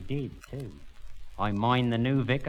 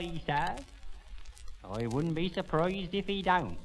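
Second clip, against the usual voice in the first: ordinary delivery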